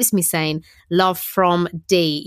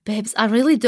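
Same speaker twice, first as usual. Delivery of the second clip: put-on botched French accent, pitched up; higher, hammed-up French accent; 4 semitones higher than usual. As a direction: try the French accent and fumble it. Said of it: "I can do a French accent".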